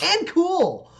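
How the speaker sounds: put-on high squeaky little girl chiming in; excited kid. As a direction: blurt it like an eager child.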